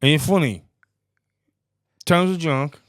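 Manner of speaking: hammed-up taunting, pushed up and loud; mock aggressive challenge. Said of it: "and he went".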